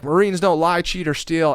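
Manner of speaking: loud, high imitation of instructors; acted shouted rebuke; preachy scolding. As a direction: recite the code as a mocking reprimand. Instructors quoting code at him.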